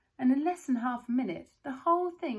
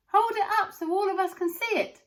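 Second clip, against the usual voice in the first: high, loud shout from the crowd; 10 semitones higher, 9 dB louder than usual. A narrator's reading. A bystander in the crowd shouts for the ticket. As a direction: call over the crowd. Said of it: "somebody shouted".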